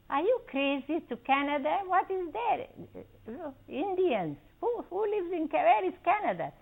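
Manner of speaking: you can't be serious, where even is that; incredulous alarm. Retold or imitated. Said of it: "My parents were beside themselves".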